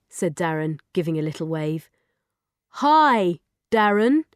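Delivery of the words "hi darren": lightly voiced robot, careful and measured. Robot greeting the boy for the first time, careful. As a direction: say it carefully like a new machine. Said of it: "said the robot carefully".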